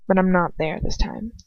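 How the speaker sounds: plain reading voice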